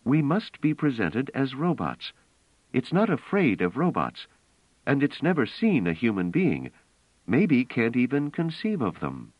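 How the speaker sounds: a woman insisting on the plan; Bliss, urgent and intent. Acted. Bliss urging Pellerat to play robots, earnest. Bliss's voice, higher than narration.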